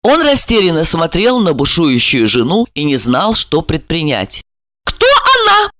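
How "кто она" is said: sharp, very high demand; lashing cry. A narrator's reading. Wife demanding rival's name, lashing cry. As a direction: fling the question out high and sharp. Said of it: "хлёстко выкрикнула Ольга".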